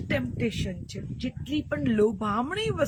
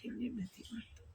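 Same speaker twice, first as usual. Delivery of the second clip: much softer, almost under the breath; soft echo; 15 dB quieter, faster than usual. Acted. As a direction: let it sink in quietly. Soft echo of previous line. (ધીમા અવાજે પડઘો)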